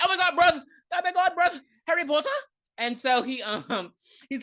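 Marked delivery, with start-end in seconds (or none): 0.0-2.3 high, loud, hammed-up British voice; mock British accent. Recapper mocks an actor who should just be British, hammed-up British. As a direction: exaggerate the British accent, pitched way up. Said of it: "Just be British. Everyone else is".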